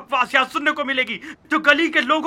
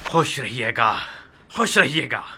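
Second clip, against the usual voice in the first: sarcastic blessing; 10 semitones lower than usual. Acted. Sarcastic farewell to the chanters.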